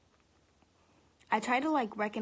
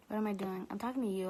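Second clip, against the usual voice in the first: ordinary delivery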